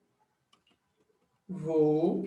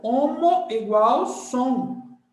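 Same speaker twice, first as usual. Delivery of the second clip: slow emphatic; 4 semitones higher, 4 dB louder, much slower than usual. Shown as a demonstration. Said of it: "teaching emphasis".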